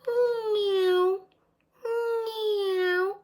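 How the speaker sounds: very high cat meows; high mewing cat; cat meowing. A narrator's reading. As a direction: meow like a cat. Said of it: "Jolly replied".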